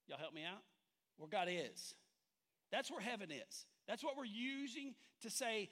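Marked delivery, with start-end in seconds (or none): none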